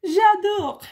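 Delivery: high, swooning French accent with a laugh; French words. Shown as a demonstration. Recalling falling in love with French, delighted French accent. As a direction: gush the French like a smitten learner. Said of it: "فرانسه عجب قشنگه".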